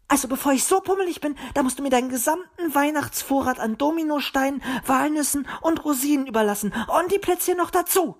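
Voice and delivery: high, indignant bird rattling on; snappish outburst. A narrator's reading. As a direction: snap back high-pitched and huffy. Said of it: "fauchte Zwitschi". (hoch und empört fauchend)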